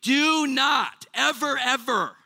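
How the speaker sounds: preacher's warning, every word of "do not ever, ever" pressed out slowly; emphatic do not ever. Shown as a demonstration. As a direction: hammer home "do not ever, ever" with a slow, rising push. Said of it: "he is emphasizing".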